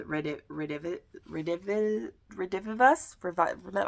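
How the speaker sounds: halting, broken attempts at "Redivivus"; struggling to pronounce. Real, not acted. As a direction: stumble over "Redivivus", restarting after the first syllables. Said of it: "I can't pronounce it".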